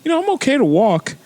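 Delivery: high, fast, insisting; high-pitched protest. Retold or imitated. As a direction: squeak out that you're fine. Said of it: "and I'm like".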